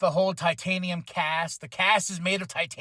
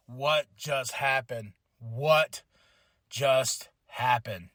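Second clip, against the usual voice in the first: slow, stunned and lost; dazed; 6 semitones lower, much slower than usual. Retold or imitated. Roman sitting dazed after the Usos turn on him, stunned and slow. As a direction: mumble it like you just got knocked silly. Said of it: "in, like, a daze".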